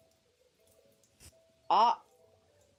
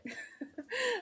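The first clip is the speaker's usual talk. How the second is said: short squeaky laugh, much higher; high sheepish giggle after the answer; high burst of laughter. Real, not acted. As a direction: let a high giggle escape.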